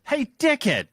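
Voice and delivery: jumps high on the greeting; mock actress take. Co-host imagining the actress in the studio, high and bright. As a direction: throw it out gleefully, high. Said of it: "Like, "Yeah, of course".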